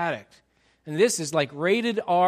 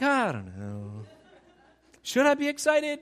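unsure congregant; 5 semitones higher than usual. Retold or imitated. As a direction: voice a doubtful churchgoer. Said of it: "and you're like".

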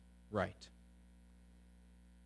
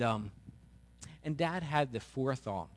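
ordinary talk

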